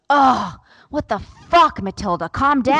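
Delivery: imitating a fed-up child, high and loud; mock outburst at a sibling's tantrum; loud, high retold exasperation; exasperated kid.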